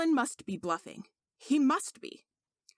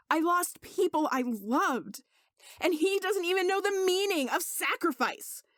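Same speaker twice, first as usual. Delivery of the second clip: anguished; 7 semitones higher than usual. Acted. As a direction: voice climbing higher, close to breaking. Merlin's confession reaching a peak.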